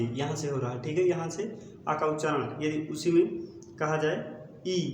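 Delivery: usual speaking voice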